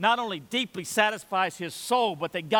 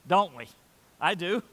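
ordinary talk, nothing marked

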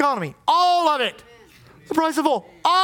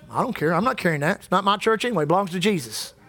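dismissive to the devil; 7 semitones lower, faster than usual. Retold or imitated. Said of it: "you know what I tell the devil?".

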